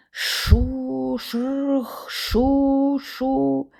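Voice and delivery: протяжне вище шелестіння кукурудзи; прошелести, як сухе листя; імітація шурхоту. A narrator's reading. Імітує шурхіт кукурудзи. (протяжний шурхіт листя)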